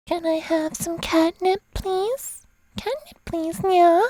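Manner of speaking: high pleading kitten voice; cat girl voice. Acted. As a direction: beg sweetly like a cat girl. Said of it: "some cat girl A S M R".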